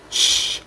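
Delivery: soft unvoiced shush; hushing shh. A narrator's reading. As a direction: hush someone with a quiet shh. Naruto shushes her in story.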